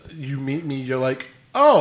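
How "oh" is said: mock surprise.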